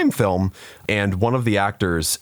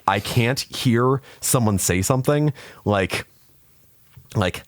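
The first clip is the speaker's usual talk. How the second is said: usual speaking voice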